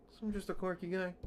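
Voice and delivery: high, fast goofy voice; quirky high voice. Pretend. Streamer calling himself quirky, high goofy voice. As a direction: say it like a silly cartoon.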